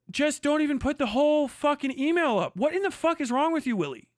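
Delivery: normal talking voice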